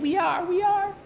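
put-on high, giggly little boy whispering from hiding; sneaky boy voice. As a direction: squeak it out like a kid hiding in a trunk. Hidden boy snickering from trunk.

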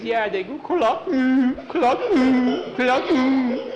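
very high, loud clucks and whimpers; squeaky mock sobbing between clucks; chicken crying. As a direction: mimic a weeping hen. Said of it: "Well, it's chicken crying".

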